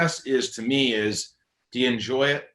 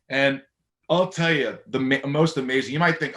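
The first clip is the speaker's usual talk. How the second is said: normal talking voice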